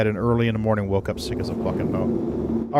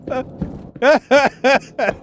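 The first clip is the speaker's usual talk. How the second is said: high laugh; 12 semitones higher than usual. Real, not acted. Laughing at own story.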